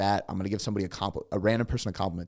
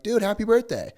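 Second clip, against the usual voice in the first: much higher and louder, cheerful burst; excited greeting; 13 semitones higher, 5 dB louder than usual. Retold or imitated. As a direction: beam it out like a surprise. Greeting a stranger on his birthday.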